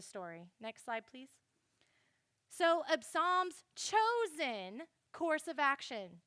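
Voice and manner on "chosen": "chosen" drawn out with heavy emphasis; stress on chosen. Shown as a demonstration. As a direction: lean hard on "chosen". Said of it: "Notice I emphasize chosen".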